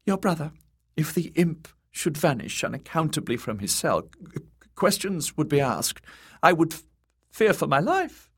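high eunuch, nervous stammer. A narrator's reading. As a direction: stumble over words up high. Varys stammers his fear.

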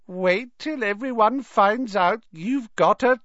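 high, slow ghostly gloating; gleeful ghost. A narrator's reading. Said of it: "with a hearty guffaw".

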